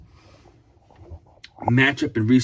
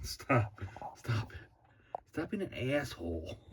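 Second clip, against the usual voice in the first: much softer, scolding under the breath; quiet aside; 5 semitones lower, 17 dB quieter than usual. Real, not acted. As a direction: hush someone off-mic, almost inaudibly. Hushed aside to someone nearby.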